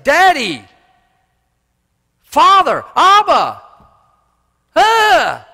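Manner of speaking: high, loud anguished cry; desperate cry. Retold or imitated. Jesus crying out to his Father, high anguished wail. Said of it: "calling out to his Father".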